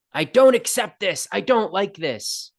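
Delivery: acted protest. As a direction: protest like someone digging their heels in. Acting out resistance to circumstances.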